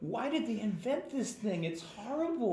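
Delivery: high, slow pained whining; whiny celebrity. Retold or imitated. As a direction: whine like a suffering celebrity. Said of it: "I've seen celebrities like".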